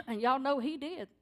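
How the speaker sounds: ordinary talk, nothing marked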